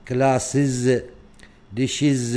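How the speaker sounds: كلاسز and ديشز with a loud iz ending; final iz sound. Shown as a demonstration. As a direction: sound out the iz at the end of each word. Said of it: "إز كأنها إز". (نطق كلاسز وديشز بمقطع إز واضح)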